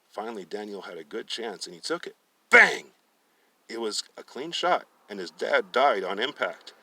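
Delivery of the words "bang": loud high bang; gunshot bang. Acted. Narrator voicing the rifle shot, loud and high.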